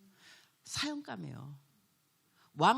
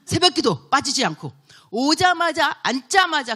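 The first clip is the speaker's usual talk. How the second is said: preaching at full force; 3 semitones higher, 10 dB louder than usual. Acted. Preacher building to loud climax.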